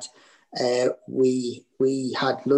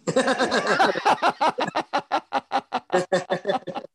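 laughing; 10 semitones higher than usual. Real, not acted. Laughs at own answer.

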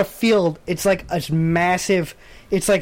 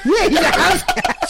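laughing; 10 semitones higher than usual. Real, not acted. Laughing at cat logo.

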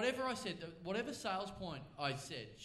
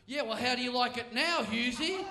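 mock taunt; 4 semitones higher, 9 dB louder than usual. Friend's taunt voiced.

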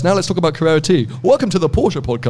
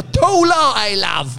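very high British voice, stretched vowels; insistent, high-pitched British accent; exaggerated British accent; 7 semitones higher, much slower than usual. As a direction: repeat the order in broad British, pitched way up. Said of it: "then you tried to speak American to her".

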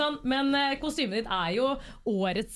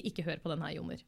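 much softer, lower pretend warning; mock-secret aside; 8 semitones lower, 10 dB quieter than usual. Pretend podcast warning to the host, hushed. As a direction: drop low and quiet like a secret. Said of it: "så kan dere bare si".

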